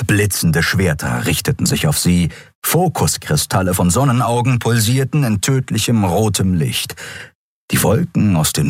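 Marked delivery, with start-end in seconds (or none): none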